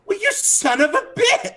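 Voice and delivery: very high indignant yelp; offended outburst. Acted. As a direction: spit it out, insulted. Guard offended by the claw.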